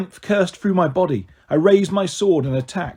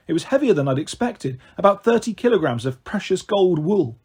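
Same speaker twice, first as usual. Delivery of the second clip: ordinary delivery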